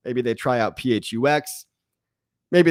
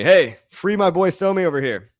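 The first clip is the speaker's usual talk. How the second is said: usual speaking voice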